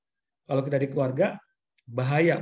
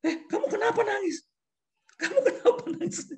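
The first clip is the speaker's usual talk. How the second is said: much higher, puzzled voice with a laugh; confused lecturer. Retold or imitated. The lecturer asking his crying student, high puzzled mock voice. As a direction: play the baffled lecturer asking. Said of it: "Sampai dosen saya bingung".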